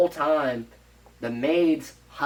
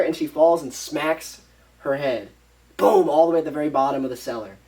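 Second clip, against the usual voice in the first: ordinary talk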